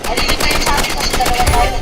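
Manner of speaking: loud callout. Real, not acted. Shouts callout in firefight.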